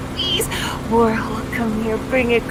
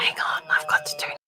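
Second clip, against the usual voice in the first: whisper; 6 semitones lower, 7 dB quieter than usual. Real, not acted. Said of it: "Why are we whispering?".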